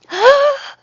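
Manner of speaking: high, loud gasp; big mock-shocked intake; dramatic gasp. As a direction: gasp as if scandalized. Reacts to comment on voice.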